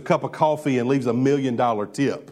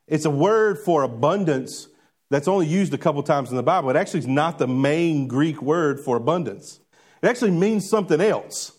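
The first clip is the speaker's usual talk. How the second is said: ordinary delivery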